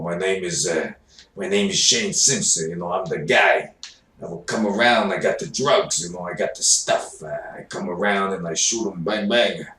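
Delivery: a hint of a tough New York guy selling drugs; New York gangster. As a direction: swagger it out like a New York dealer. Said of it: "the New York guy".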